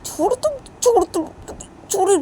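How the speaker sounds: put-on pleading little girl, high and loud; shrill kid voice tugging away; high child voice. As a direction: cry it out like a small child.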